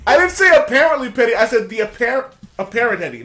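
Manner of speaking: indignant protest. Real, not acted. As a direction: defend the wording, loudly. Loud indignant correction.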